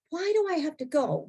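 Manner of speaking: protesting whine. Retold or imitated. Woman arguing with her inner voice about going, exasperated protest. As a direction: push the pitch up in protest.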